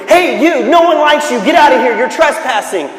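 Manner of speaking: loud, high hostile shout; harsh warning shouted at a stranger; yelling voice. A narrator's reading. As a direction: yell to chase off an intruder. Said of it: "he kept hearing it over and over".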